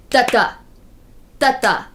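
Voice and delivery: the iamb rhythm spoken as da-DA da-DA; iamb beat. Shown as a demonstration. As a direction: sing da-da like a heartbeat, weak then strong. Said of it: "a set of syllables that goes".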